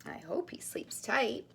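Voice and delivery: soft whisper. Real, not acted. Whispered aside.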